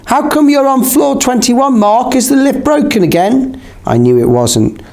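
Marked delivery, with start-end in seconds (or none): 0.1-3.6 high-pitched call, volume held back; mild shout after someone. A narrator's reading. Narrator calls after Mark on the stairs, mild shout. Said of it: "I shouted after him".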